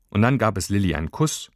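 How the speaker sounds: ordinary delivery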